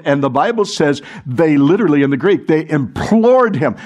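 usual speaking voice

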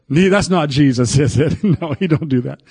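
ordinary delivery

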